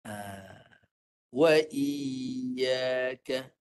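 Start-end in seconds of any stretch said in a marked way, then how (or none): 1.3-3.5 "wa iyyaka" with the ya pressed clearly from mid-tongue, very slow; clear ya demo. Shown as a demonstration. Ustaz modelling the correct ya for the student, slow. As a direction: stretch "iyyaka" and make the ya ring clear. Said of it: "nak bagi dengar lagi jelas, ya mati".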